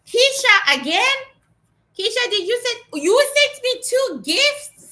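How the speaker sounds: can't believe a second gift arrived; surprised. Real, not acted. Finding a second gift from Keisha, high surprised delight.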